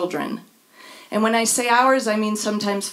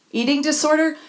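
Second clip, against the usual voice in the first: normal talking voice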